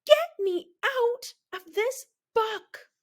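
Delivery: high, panicked cry; high frightened scream. A narrator's reading. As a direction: shriek to escape the shark page. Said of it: "and I screamed".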